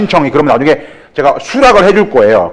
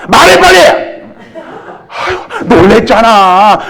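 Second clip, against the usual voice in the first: much higher, laughing mock yell; 7 semitones higher, slower than usual. The wife in the joke snaps at her husband, laughing, in a much higher mock yell. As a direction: bark it at the husband, then laugh.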